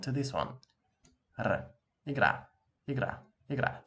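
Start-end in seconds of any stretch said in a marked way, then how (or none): 2.1-3.8 igra repeated with a rolled r; igra, trilled r, falling each time; rolled r demo. Shown as a demonstration. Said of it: "Try to do that".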